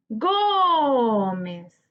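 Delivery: "Gómez" with a very long, held GÓ; stressed syllable stretched. Shown as a demonstration. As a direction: hold the first syllable of "Gómez", then drop to mez. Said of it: "Busquemos la sílaba tónica". ("Gómez" alargando mucho la sílaba tónica GÓ)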